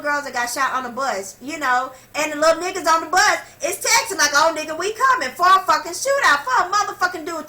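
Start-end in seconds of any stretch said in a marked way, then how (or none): 2.1-7.5 loud, high-pitched, worked-up rant; worked up and loud, hammering it out; heated rant. Real, not acted. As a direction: rant at full tilt, voice raised and pushed up. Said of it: "I done went on this rant".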